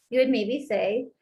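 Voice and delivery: normal talking voice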